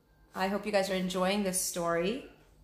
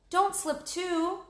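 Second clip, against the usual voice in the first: Annie warning; 11 semitones higher, slower than usual. Acted. Annie warns Jack.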